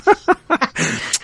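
very high, shrieking laugh; real squealing laugh. Real, not acted. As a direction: burst out laughing. Laughs after on-air shoutout.

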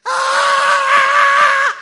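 very loud, high-pitched shriek; terrified scream. Retold or imitated. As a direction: imitate the brave hunter shrieking in terror. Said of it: "la expresión de mi amigo, el cazador de ratas, fue esta". (chillido agudo de terror)

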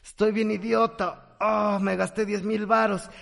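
slow, drawn-out groan of remorse; mock moral-hangover lament; hungover regret. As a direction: moan as if waking to last night's spending. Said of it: "podríamos despertar con cruda moral".

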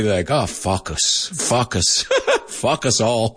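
focus said like fuck us, as students do; mock a student saying focus as fuck us; imitating students' mispronunciation. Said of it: "he oído muchos alumnos decir eso".

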